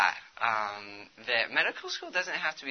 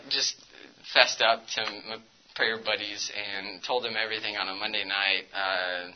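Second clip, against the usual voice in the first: ordinary talk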